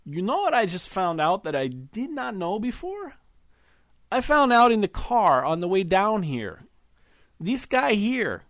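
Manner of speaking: put-on husband needling his wife's old fiancé, slurring drunkenly; drunken slur. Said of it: "said in a slurred accent".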